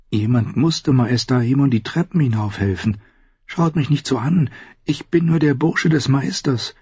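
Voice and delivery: put-on a shy boy defending himself; nervous young Sam. A narrator's reading.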